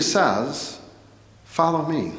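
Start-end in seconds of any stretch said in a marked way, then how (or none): none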